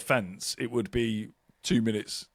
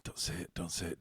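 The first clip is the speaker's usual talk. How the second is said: much softer, lower hushed self-warning; inner muttering; 7 semitones lower, 15 dB quieter, faster than usual. Retold or imitated. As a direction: hold it in under your breath. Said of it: "and you go".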